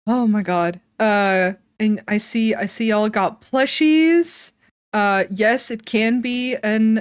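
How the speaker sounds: ordinary talk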